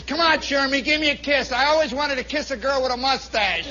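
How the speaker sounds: acted barracks heckler; lout's taunt. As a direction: coax mockingly for a kiss. Lout taunting Shermy, acted.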